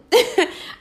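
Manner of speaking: loud high laugh; a sudden loud, high laugh; burst of laughter. Real, not acted. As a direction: crack up at yourself. Laughing at her own joke.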